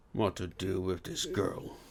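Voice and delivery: a hint of quiet musing about the girl. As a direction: think it over under the breath. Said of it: "he mused".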